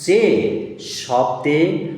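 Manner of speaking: each word spaced for copying; teacher dictating a definition word by word; slow dictation. Shown as a demonstration. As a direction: read the rule out slowly so it sticks. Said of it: "আমি ডেফিনেশনটা পড়ছি".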